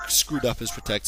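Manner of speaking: ordinary delivery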